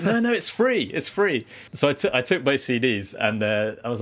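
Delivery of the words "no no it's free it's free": high, insistent mock seller voice, much higher; pushy street seller. Street CD seller insisting to the tourist, high mock voice. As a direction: squeak it out like an eager hustler insisting. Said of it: "And they were like".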